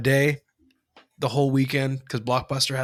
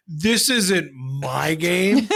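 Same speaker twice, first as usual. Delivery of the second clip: put-on slow, higher, indignant kid protest; sassy kid; 5 semitones higher, 4 dB louder, slower than usual. Kid correcting the store clerk, sassy. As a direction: drag it out with mock sass. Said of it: "Little sassy [name]".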